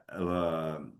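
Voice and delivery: ordinary talk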